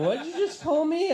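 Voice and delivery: high, loud mock indignation; offended demand. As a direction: demand it as the insulted lover. Girl's offended reaction acted.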